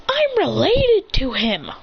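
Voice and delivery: high, drawn-out astonished outburst; amazed exclamation. Acted. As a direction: stretch it out in acted disbelief. Character reveals kinship in amazement.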